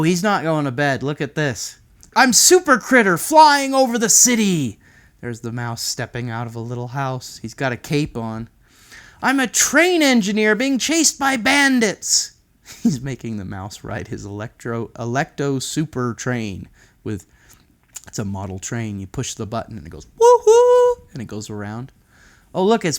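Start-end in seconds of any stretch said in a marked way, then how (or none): 2.2-4.8 put-on high, loud superhero kid; heroic kid voice. Child plays superhero. 9.2-12.3 put-on high, loud, excited kid; chase-game excitement. Child plays train engineer. 20.2-21.1 very high loud toy whoop; train woohoo. Acted.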